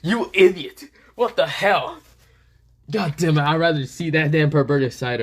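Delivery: loud, high exasperated scolding; flustered outburst. A narrator's reading. Drake berating Issei as an idiot, flustered outburst. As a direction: burst out at him, flustered and cross. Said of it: "Drake right now has a flustered face".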